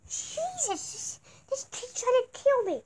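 high, strained panicked yelp; panicked cry. Acted. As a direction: cry out like under attack. Panicked, being attacked.

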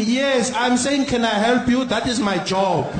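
slow, patient over-explaining. Acted. Agent Doris slowly spells out her job to the caller, patiently. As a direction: drag it out like to a child.

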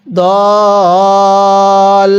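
দল with the vowel held four alif counts; long madd on dal. Shown as a demonstration. Teacher showing students দল with a four-count pull. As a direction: stretch the vowel of দল long and steady. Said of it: "দদের মধ্যে চার আলিফ টান দিব".

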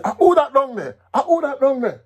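high, loud challenge into the dark; gun-holding soldier shouting into the night; soldier's call. Acted. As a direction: call out sharply at an intruder. Soldier calls into dark yard.

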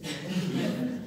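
quiet amused laugh; soft chuckle. Real, not acted. Preacher chuckling softly at the congregation. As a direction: laugh under the breath.

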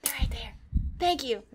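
breathy near-silent relief; whisper. Real, not acted. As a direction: hush it out once found.

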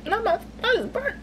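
high squeaky dismay at burnt toast; dismayed squeal. Real, not acted. Burning her toast, a high dismayed squeal. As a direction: let it squeak out high, caught off guard. Toast burns while cooking.

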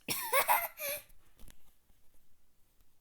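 shrill burst of laughter; high shrieking laugh. Real, not acted. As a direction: cackle high and loud. Key missed, bursts out.

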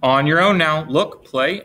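ordinary delivery, nothing marked

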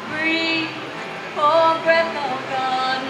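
usual speaking voice

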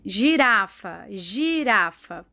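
slowly stretched syllables; teacher sounding out a word, drawn out; slow dictation. Shown as a demonstration. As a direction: say it slowly so children can spell it. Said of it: "igual a Pro está falando".

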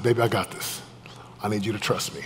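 soft, low and steady promise; tender reassurance. Retold or imitated. Husband steadying his sobbing wife, tender reassurance. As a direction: quietly reassure a loved one. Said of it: "grabbed her hands, looked her in the eyes".

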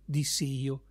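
ordinary delivery

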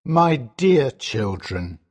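low, slow, sorrowful father; sad father. A narrator's reading. Father breaking bad news to his children, sadly. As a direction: address them slowly and heavily, in a low voice. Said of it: "he says sadly".

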